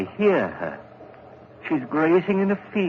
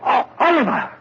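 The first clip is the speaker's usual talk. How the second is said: shocked shout, much higher and louder; horrified cry; 11 semitones higher, 5 dB louder than usual. Acted. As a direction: cry out in horror at what he sees. Simon sees the crushed bird.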